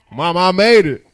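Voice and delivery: loud, high proud yell; excited shout. Retold or imitated. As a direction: shout it like you made it big. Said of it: "call your mom and say".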